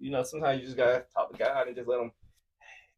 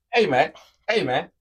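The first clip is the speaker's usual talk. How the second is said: loud, higher, demanding hey man; impatient shout; 7 semitones higher, 9 dB louder than usual. Retold or imitated. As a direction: call him out sharply over the trash. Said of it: "what patience look like and what it don't look like".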